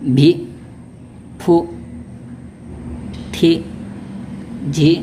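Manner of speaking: one syllable at a time with pauses; syllable drill. Shown as a demonstration. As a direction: read each syllable clearly, pausing between. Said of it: "देखिए".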